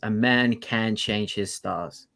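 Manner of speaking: solemn movie quote. Retold or imitated. Quoting the film line slowly.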